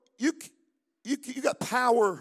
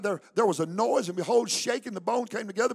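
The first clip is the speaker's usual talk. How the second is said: ordinary talk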